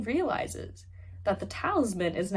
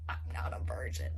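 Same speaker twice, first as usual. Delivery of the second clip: whispered confession; 11 semitones lower, 8 dB quieter than usual. Retold or imitated. Amma's confession quoted.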